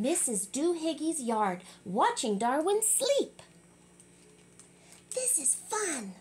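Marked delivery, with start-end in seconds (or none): 5.1-6.1 softer, higher deadpan line; deadpan quiet. Acted. Sister quietly watches Darwin sleep.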